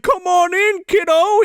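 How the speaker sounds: high, jolly beckoning call; mock Santa. Santa inviting the kid to swim, high mock cheer. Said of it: "And he's like".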